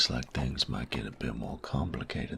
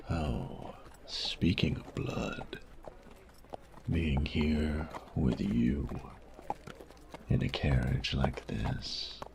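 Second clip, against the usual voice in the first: slow, breathy, menacing whisper; slower than usual. Acted. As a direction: savour every word, slow and hushed. Menacing, intimate turn to blood.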